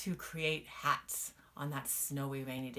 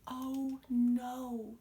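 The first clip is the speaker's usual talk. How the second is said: gasp; 7 semitones higher, 4 dB louder than usual. Acted. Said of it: "she gasped".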